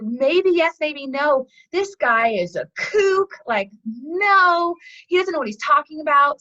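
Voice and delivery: indignant, dismissive retelling of an offended farmer; scoffing disbelief. Retold or imitated. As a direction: throw it back, loud and scornful. Farmer in parable scoffs at sage.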